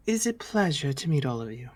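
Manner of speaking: a hint of a gracious young princess; princess greeting. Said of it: "she looks at the rest of you and goes".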